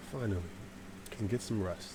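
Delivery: soft, low sigh of relief; hushed, drowsy relief; weary relief. Retold or imitated. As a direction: sigh it out quietly and settle down to rest. Relieved when the noise stops.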